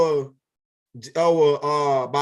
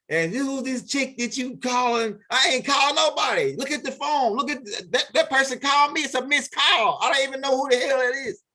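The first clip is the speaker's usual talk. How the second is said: indignant protest; 7 semitones higher than usual. Retold or imitated. Man denying the call to his angry partner, high-pitched protest. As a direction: pitch jumps way up, pleading innocence in a rush.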